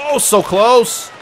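breathless gasp, drawn-out so close; sharp gasp. Real, not acted. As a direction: gasp at the near miss. Near goal in game.

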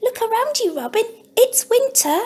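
a tiny mouse, matter-of-fact; squeaky mouse. Acted. Mouse keeps explaining.

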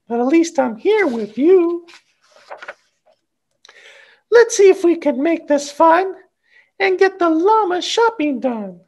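put-on high, gentle mama voice coaxing her child; Mama comforting. Mother coaxes her fussing child in a high gentle voice. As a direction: soothe him and make it a game.

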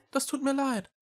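high-pitched small cat voice; apologetic little cat. A narrator's reading. (hohe Katerstimme, entschuldigend)

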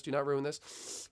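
tense self-warning. Real, not acted.

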